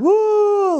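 high, drawn-out mock bawling moan; hammed-up high-pitched moan; loud imitated wail. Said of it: "really loud".

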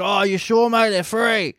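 put-on chummy policeman's pitch; friendly cop. Acting out the cop insisting.

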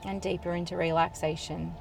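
soothing trance induction, unhurried and hushed; guided hypnosis. Acted. Leads a hypnosis session.

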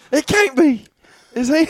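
mock awe. Mock awestruck fan.

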